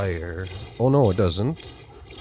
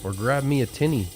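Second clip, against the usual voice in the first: Canadian slang; 6 semitones higher than usual. Shown as a demonstration. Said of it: "A tinny is like a can of beer".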